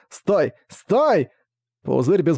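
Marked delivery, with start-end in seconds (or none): 0.1-0.5 urgent stop. Acted. King begs to stop. 0.7-1.3 desperate shout. Acted. King begs to stop, louder.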